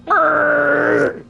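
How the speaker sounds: high-pitched drawn-out screech noise; imitated car screech. Storyteller imitating a car peeling into the entrance, screeching noise.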